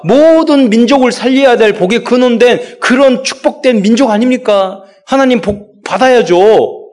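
rising, emphatic sermon climax, slowing on the last line; fervent preaching. Acted. Pastor exhorting the congregation at full force. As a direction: preach it out with mounting fire.

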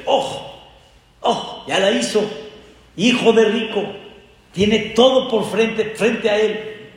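mock awe at the rich man's son; onlookers' envious remark. People watching the rich man's son, mock awed. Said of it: "Cualquiera hubiera dicho".

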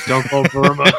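loud mock action hero; Rambo impression. Rambo unable to switch it off, mock terse warning. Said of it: "it's John Rambo".

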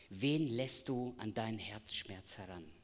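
ordinary delivery

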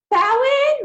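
loud, high call mispronouncing the name "Bowen?"; bellowing nurse. Retold or imitated. Nurse bellowing the patient's name, loud and high. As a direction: belt out "Bowen?" like a loud nurse across the room. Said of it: "the nurse with this big bellowing, loud voice".

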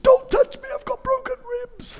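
very high, squealed panic; hammed-up friend begging not to be touched; mock terrified. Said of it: "he looks terrified".

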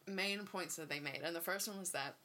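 ordinary talk

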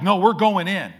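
hammed-up stubborn refusal; stubborn Israelites. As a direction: insist like a stubborn crowd. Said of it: "So they're like".

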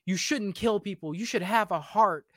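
hammed-up pleading heroine voice; mock naive heroine. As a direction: parody the preachy girl pleading. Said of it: "And she's like".

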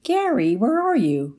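high, bright chirpy calling out; wife chirping. Retold or imitated. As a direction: sing her husband's name up high. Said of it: "my wife chirping".

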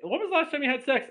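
high put-on voice; high-voiced mock questioning; long-neglected character. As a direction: ask it in a comic high pitch. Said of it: "can you imagine, like, it's been".

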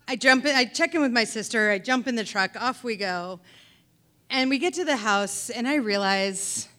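usual speaking voice

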